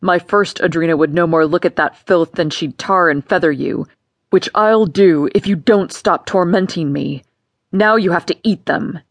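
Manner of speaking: a cruel father threatening his stepdaughter; stern father. Acted. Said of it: "he added".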